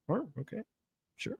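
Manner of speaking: ordinary talk, nothing marked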